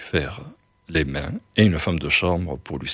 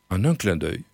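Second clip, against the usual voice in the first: usual speaking voice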